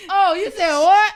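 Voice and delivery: high, loud, slow incredulous "you said what?"; mock outrage. Reading a petty email reply, loud, high mock shock. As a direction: drag it out in mock disbelief.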